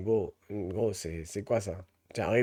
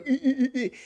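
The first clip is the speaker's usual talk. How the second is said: mock girly giggle; 9 semitones higher than usual. Mocking girls giggling.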